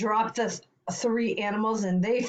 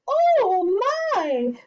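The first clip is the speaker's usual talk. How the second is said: put-on loud, very high startled old woman; startled cry; 12 semitones higher, 6 dB louder than usual. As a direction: shriek in storybook fright. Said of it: "cried the tiny old woman".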